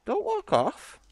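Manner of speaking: very high pleading call; calling to the cat. Real, not acted. Streamer begging his cat not to walk off, very high.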